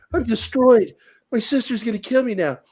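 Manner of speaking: high-pitched mock wail of doom; hammed-up distraught friend; imitated despair. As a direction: whine like a doomed kid. Voicing distraught Rolf.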